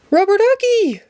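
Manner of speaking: shocked call for the missing duck; sudden high shout of alarm; alarmed cry. Acted. Ernie discovers duck gone.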